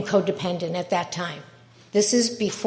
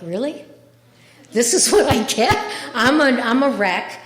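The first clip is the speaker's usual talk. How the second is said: rising, louder, fed-up retort; exasperated disbelief; 4 semitones higher, 5 dB louder than usual. Retold or imitated. To the meeting leader, exasperated and louder. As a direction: throw it back, incredulous.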